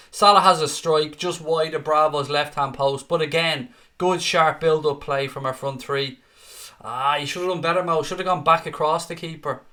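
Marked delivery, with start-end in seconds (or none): none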